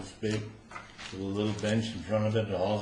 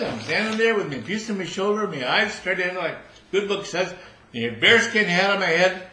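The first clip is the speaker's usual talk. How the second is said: raised, louder guard voice; soldier's testimony; 7 semitones higher, 6 dB louder than usual. Acted. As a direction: tell it straight. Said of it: "he says".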